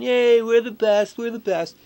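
a hint of a smug cheering fan; mock bandwagon fan. Mocking bandwagon fans cheering when winning. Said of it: "when they're winning, they're on the bandwagon".

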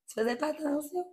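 high, mocking quote of a judgmental person; mocking a nosy comment. Repeating what people tell her about her weight, high and mocking. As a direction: pitch it up like the busybody asking. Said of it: "quelqu'un va dire".

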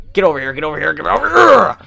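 escalating gamer holler; building to a yell. Real, not acted. Chasing an enemy in the game.

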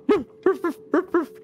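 high yappy dog barks; dog barking. Acted. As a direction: bark like a small dog. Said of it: "you just hear Nugget going".

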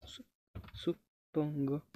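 faint unsure mumble; trailing mumble. Real, not acted. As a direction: let "suppongo" fade out doubtfully. Unsure after killing monster.

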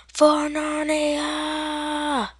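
high, long-held mock war cry; playful heroic charge call; battle cry. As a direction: stretch the last vowel out high.

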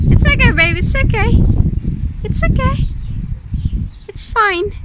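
calm a panicking pet firmly; soothing a scared dog. Real, not acted. Calming a frightened pet.

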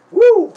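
high, loud whoop of joy; a gleeful yell of delight; excited woo. Real, not acted. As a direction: let out a big happy woo. Excited cheer.

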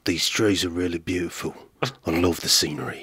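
low, soft gruff action-hero murmur; lightly voiced tough guy, low and quiet; Jason Statham. Doing Jason Statham for a friend, low and gruff. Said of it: "I could do Jason Statham".

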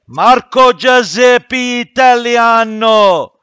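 slow, hammed-up Italian shout; mock Italian accent. Teacher clowning for the class in a mock Italian accent. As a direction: ham up a fake Italian name, drawn out and loud. Joking about switching to Italian.

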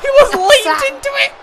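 very high, loud disbelieving cry; shocked shriek. Real, not acted. Player reacts to a wild leap, shrieking high shock. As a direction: squeal in shock at the screen.

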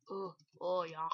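ordinary talk, nothing marked

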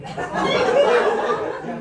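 shrieked and laughing, very high; squealing cracker. Real, not acted. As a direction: shriek the word out. Audience member shouts cracker.

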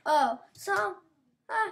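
ordinary talk, nothing marked

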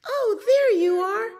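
high, loud, delighted greeting; little girl's voice. A narrator's reading. Little girl finding her kitty, high glad cry. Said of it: "said the little girl".

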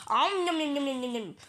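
playful nom-nom chomping; cartoon eating sounds; greedy munching noises. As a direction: gobble hay with voiced nibbles. Cow munches hay.

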